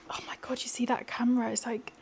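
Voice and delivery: hushed night whisper. Real, not acted. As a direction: keep it hushed, late evening.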